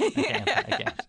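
"I can't" squeaked up high; jokey high-pitched try at her voice; mock high attempt at friend's voice. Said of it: "can you talk like me?".

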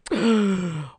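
high, loud sharp gasp. Retold or imitated. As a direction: gasp in mock shock. Retold shock.

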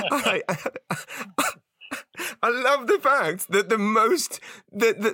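high, laughing delight; giggling disbelief as the story sinks in; laughing amazement. Real, not acted. Host laughs that the famous phone box is stolen.

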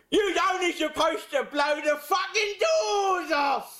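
high, slow, shouted film-star send-up; hammed-up high-pitched catchphrase shout; Michael Caine. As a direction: yell it slowly in a high mock Cockney. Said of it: "I'll do Michael Caine".

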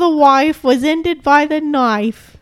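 slow, high, hammed-up Cockney; Family Guy Cockney parody. Reciting a Family Guy parody to a co-host, overdone mock Cockney. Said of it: "they parody it in The Family Guy".

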